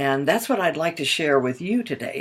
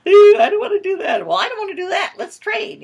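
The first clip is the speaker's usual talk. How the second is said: put-on high, loud childish whine; whiny kids; 9 dB louder than usual. Children complaining about chores, high and whiny. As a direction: whine it like a kid stuck with a chore. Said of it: "they go".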